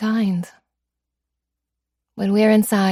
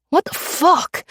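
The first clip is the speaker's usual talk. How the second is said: much higher, sharp fast what, falling; panicked disbelief; 10 semitones higher, slower than usual. Acted. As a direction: blurt it out in panic. Mugger panics under the gun.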